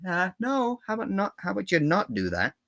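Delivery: high, prissy mock refusal; YouTube. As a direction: put on a high voice to turn someone down. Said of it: "YouTube took one of my videos down and said".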